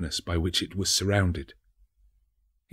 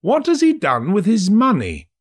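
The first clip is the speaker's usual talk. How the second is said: high, loud, greedy question; a nosy rich gentleman; red-faced gentleman; 12 semitones higher, 6 dB louder than usual. A narrator's reading.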